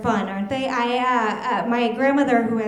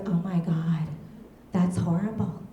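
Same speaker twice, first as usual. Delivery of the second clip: lightly voiced mom, lower and hushed; concerned mom; 3 semitones lower, 3 dB quieter than usual. Mom reacting to a horrible neighborhood story, mildly grave. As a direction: imitate a mother's grave, softer reaction.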